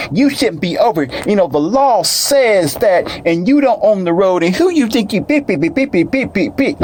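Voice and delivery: hammed-up high Karen squawk; mocking Karen voice. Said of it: "she was still talking crazy".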